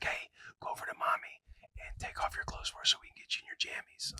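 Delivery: hushed secret whisper. Retold or imitated. Dad sending daughter to mommy for jammies, whisper. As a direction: whisper the plan into her ear. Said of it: "I'm whispering right now".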